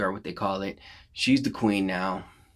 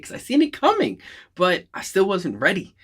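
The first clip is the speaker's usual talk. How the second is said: much higher, emphatic burst; excited outburst; 9 semitones higher, 3 dB louder than usual. Real, not acted. Fan recalling Tony's death, high excited burst. Excited recap of emotional movie.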